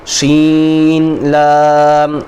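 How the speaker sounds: شین لام recited very slowly, each letter held; slow reciting; drawn-out letters. Shown as a demonstration. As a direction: spell شین لام out long for a child. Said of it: "ٹھیک ہے، سین لام،".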